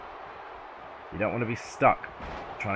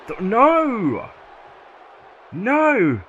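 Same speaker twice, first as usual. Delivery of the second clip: shouted, much higher and louder no; excited disbelief; 12 semitones higher, 9 dB louder, much slower than usual. Real, not acted. Commentator reacting to the kickout, shouted disbelief. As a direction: yell no as the count gets broken. Near-fall kickout on the count.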